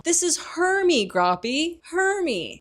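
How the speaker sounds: sing-song it loud and slow for a giant; Hagrid voice. Retold or imitated. Hagrid presenting Hermione to Grawp, slow and loud.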